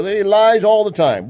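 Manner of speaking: "lies" pushed high, loud and slow, mocking; mocking the media. Mocking the media's claim, "lies" drawn out high and loud. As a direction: ham up the outraged accusation, dragging "lies". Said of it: "the mainstream media says that Trump lies".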